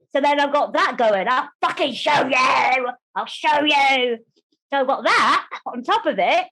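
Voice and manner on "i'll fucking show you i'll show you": slow, defiant mock shout; hammed-up defiance, stretched and forceful; show-you attitude. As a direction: draw each word out with fierce determination. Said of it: "that kind of show you attitude".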